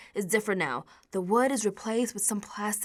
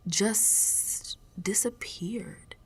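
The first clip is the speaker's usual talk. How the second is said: slow breathy whisper, much softer; 8 dB quieter, much slower than usual. Acted. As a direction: let the word dissolve into a whisper. Print vanished from wall.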